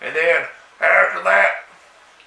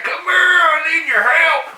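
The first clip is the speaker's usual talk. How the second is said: shouting to the jogger for help; calling out; 10 semitones higher than usual. Retold or imitated. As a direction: call her over, urgent.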